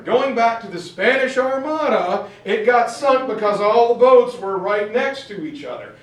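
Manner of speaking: mock-bark like an outraged general, pointing out every flaw; Patton ranting. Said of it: "he looks around and he says".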